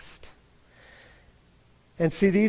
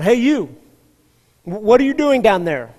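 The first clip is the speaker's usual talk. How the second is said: higher, much louder shout from the bridge; police officer yelling; 5 semitones higher, 10 dB louder than usual. Retold or imitated. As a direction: bark it down to the riverbank. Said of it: "And he yells down".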